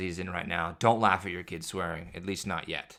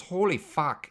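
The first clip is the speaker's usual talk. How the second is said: put-on high, louder toddler exclamation; imitating son swearing; 8 semitones higher, 4 dB louder, slower than usual. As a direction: blurt it like a kid who dropped something. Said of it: "just goes".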